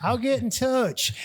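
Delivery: high-pitched, amazed TV host impression; mock gushing enthusiasm, high and sustained; Hugh. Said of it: "He goes".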